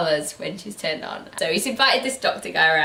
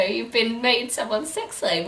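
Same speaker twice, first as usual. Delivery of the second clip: ordinary talk, nothing marked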